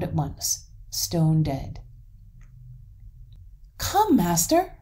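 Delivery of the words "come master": high, loud summons; raised, high-pitched call. A narrator's reading. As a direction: call someone over from across the garden. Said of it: "called Hans".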